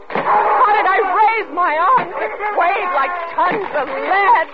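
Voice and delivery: loud, high, tearful outpouring; building to wailing cries, words stretched; hysterical. Acted. Wife reliving bringing it down on him, hysterical. Wife recalls striking husband down.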